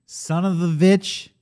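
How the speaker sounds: "witch" said as "Vitch", slow and drawn out; mock accent "Vitch". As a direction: lean on "Vitch" like a movie villain. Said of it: "the Vitch".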